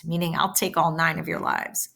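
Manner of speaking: normal talking voice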